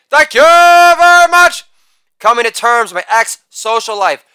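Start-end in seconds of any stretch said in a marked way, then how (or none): 0.1-1.6 high, loud, sustained catchphrase; showman's ringing thank-you; showy sign-off. Acted. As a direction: belt it out on one held high note.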